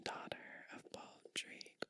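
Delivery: usual speaking voice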